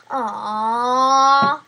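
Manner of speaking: loud whining aww; disappointed aww. Real, not acted. Disappointed at reward news.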